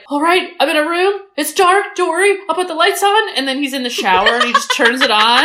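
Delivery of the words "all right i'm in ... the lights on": imitating Tim Curry. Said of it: "slapstick humor where he was like".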